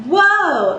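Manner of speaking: bright spoken high note; high talking note. Shown as a demonstration. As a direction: pop up to a high spoken whoa. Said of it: "that note specifically".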